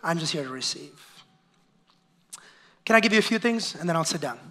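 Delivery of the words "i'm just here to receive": much softer, lazy murmur; passive churchgoer. Retold or imitated. Pastor imitating a passive churchgoer who only receives, soft and limp. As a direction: drop it low and limp like someone who only takes. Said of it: "more of a".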